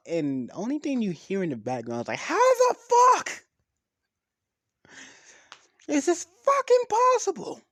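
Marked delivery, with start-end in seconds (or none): 2.2-7.6 very high, loud screech of disbelief; yelled outburst. Retold or imitated. Issei heard in the background, screeching in disbelief. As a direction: scream it out from the background. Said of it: "he just yelled this out".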